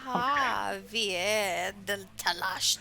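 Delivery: slow, drawn-out silly announcing. Acted. Game master calling a player's turn, silly voice. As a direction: stretch the name out in a goofy voice. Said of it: "silly Z voice".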